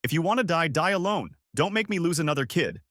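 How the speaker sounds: restrained shout at someone in danger; mild shout. A narrator's reading.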